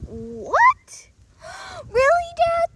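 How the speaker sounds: high, loud gasping disbelief; amazed gasp. Acted. Son amazed that dad lets him explore, gasping and high.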